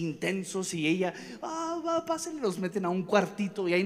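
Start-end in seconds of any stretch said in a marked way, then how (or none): 1.4-2.4 high, small scared voice; timid receptionist. Retold or imitated. Scared receptionist answering the yelling father, high and timid. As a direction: meekly give way to the angry father.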